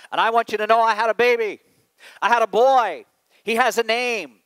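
widow's long-held words, voiced forcefully; emphatic declaration. Widow breaks long silence about baby.

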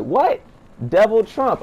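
high, loud double-take; shocked disbelief. Retold or imitated. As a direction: jump up in disbelief. Shocked at the name.

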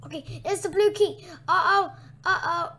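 loud laughing alarm; excited laugh. Real, not acted. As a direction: laugh it out, then panic. Spots the key, guard near.

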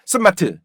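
스마트 in three even syllables; wrong Korean-style reading. Shown as a demonstration. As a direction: read 스마트 as seu-ma-teu. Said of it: "이렇게 읽으면 저도". (한국식으로 읽는 스마트)

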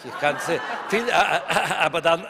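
breaking into laughter mid-sentence; laughing. Real, not acted. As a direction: laugh through the words. Guest laughs about saying more.

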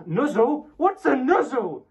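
high, loud questioning of a slip; self-mocking disbelief. Real, not acted. Presenter catches his own slip of muzzle, loud mock disbelief. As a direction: query your own wrong word, incredulous.

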